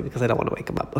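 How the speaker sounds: hushed whisper; whispering to not wake the dog; talking softly. Real, not acted. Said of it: "talking really softly".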